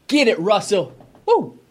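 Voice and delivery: high, whooping cheer for a big shot; excited cheering. Real, not acted. Fan cheering a replay of Russell's three, excited whoop. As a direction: cheer the player on like he's in the arena. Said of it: "you're so funny 'cause, like, it's a recorded game".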